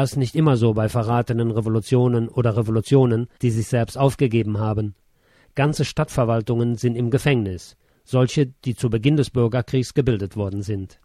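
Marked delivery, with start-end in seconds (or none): none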